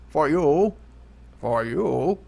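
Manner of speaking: a hint of a slow villain drawl; half-hearted Bane line; lightly voiced Bane. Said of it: "It's not even fucking Bane anymore".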